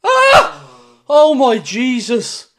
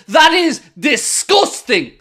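normal talking voice

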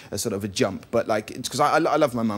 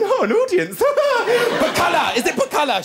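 much higher, louder, slow and thrilled; excited mum; 5 dB louder than usual. Retold or imitated. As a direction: mum showing off to the crowd. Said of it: "My mum's there going".

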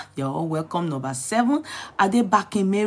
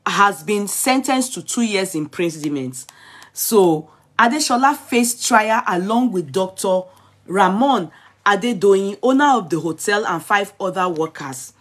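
lightly raised news-reading voice, higher and louder; a touch of newscaster; 3 semitones higher, 4 dB louder than usual. Acted. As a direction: read the headline out to the viewers. Reads news report aloud.

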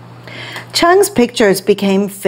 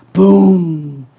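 booming thunder; 3 semitones lower than usual. Acted. Thunder sound word.

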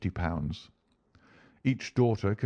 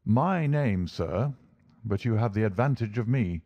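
usual speaking voice

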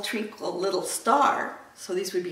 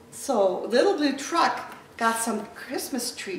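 ordinary delivery

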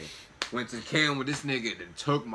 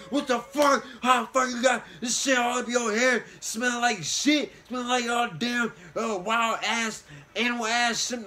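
higher, louder scolding mom voice; mad mom; 11 semitones higher, 5 dB louder than usual. Retold or imitated. Mom scolding son about camel spit in his hair. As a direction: play the furious mother fussing at her kid. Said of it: "Moms was mad as hell".

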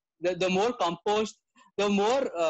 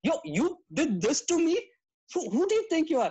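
higher, affronted mock outrage; indignant outburst; 9 semitones higher than usual. Rude guy reacting to being corrected, indignant. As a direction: play the offended guy flaring up.

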